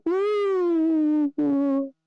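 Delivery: long, high-pitched "woo"; children's awed woo. Acted. Kids marvel at the carousel toy, high awed woo. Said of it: "and they're like".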